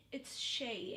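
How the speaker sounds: ordinary talk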